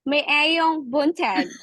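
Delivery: "maayong buntag" slowly with flat American vowels; American accent. Retold or imitated. Teacher helping students with Bisaya words, "maayong buntag" in American accent. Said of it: "I'll say it in your accent".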